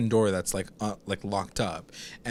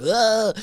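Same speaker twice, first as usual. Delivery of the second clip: stabbed grunt; 10 semitones higher, 3 dB louder than usual. Retold or imitated. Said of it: "and he's like".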